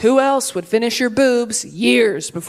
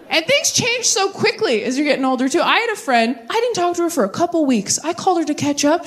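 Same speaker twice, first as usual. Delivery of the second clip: ordinary delivery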